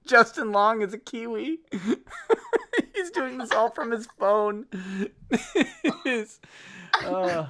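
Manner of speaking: normal talking voice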